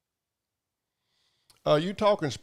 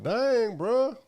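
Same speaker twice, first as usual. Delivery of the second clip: higher, mock-amazed dang bruh; imitating amazement; 9 semitones higher than usual. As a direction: act stunned by the truck.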